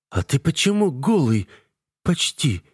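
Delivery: put-on high, startled young woman's voice; flustered sister. A narrator's reading. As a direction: ask it high and taken aback.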